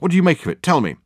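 eager, high-pitched prompting; demanding. A narrator's reading. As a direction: press hard for an opinion. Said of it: "demanded Dirk".

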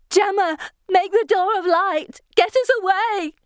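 high, frantic pleading scream; hysterical scream. A narrator's reading. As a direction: scream for help, nearly hysterical. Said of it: "screams, nearly hysterical".

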